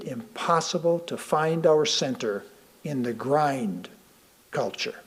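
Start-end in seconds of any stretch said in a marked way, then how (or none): none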